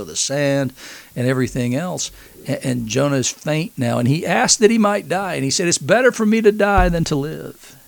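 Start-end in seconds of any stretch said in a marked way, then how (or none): 5.7-7.8 higher, louder mock-despairing whine. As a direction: voice Jonah's complaint, self-pitying and higher. Jonah's despairing complaint voiced.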